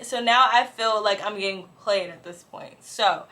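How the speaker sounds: ordinary talk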